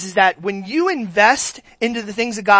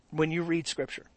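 normal talking voice

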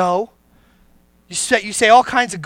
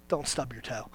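low, much softer "Don't stub your toe" tossed off under the breath; hushed low-key "Don't stub your toe"; muttered warning; 10 semitones lower, 18 dB quieter than usual. Shown as a demonstration. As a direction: mutter "Don't" low and soft, almost to yourself. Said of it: "I know what comes out of you when you stub your toe".

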